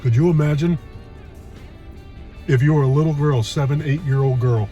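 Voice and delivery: ordinary talk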